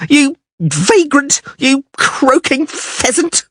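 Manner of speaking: high, slow shrieking insults; screaming woman. A narrator's reading. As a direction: fling each insult down shrieking. Blonde keeps screaming insults.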